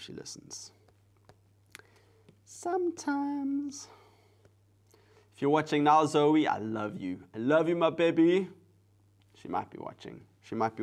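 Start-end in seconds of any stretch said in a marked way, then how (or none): 2.4-3.9 very high, drawn-out little voice; playful singsong. Pretend. Mother joking about whether her daughter listens, high playful squeak. Playful echo of own remark. 5.3-8.5 higher, lilting affectionate call. Real, not acted. Mother to her daughter who might be watching, doting and higher-pitched. Talks to daughter through the camera.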